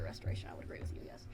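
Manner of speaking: whisper. Acted. Aside in the shop scene.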